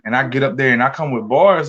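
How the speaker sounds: ordinary talk, nothing marked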